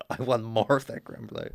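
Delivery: imitating Sharpay in an unplaceable accent; odd accent. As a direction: put on a muddled, odd accent for the quote. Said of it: "What accent was that?".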